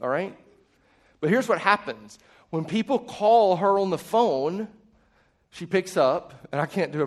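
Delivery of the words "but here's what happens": ordinary delivery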